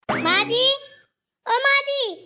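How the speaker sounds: high-pitched, drawn-out holler; calling out loudly. Acted. Daughter-in-law summons mother-in-law to come over, calling out loudly. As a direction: holler to fetch someone from afar. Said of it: "क्यों गला फाड़ फाड़ चिल्ला रही है".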